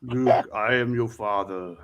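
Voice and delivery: low, slow Vader through a fan; Darth Vader voice. Retold or imitated. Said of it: "talked in the fan to sound like Darth Vader".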